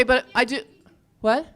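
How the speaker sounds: ordinary delivery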